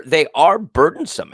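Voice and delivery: ordinary talk, nothing marked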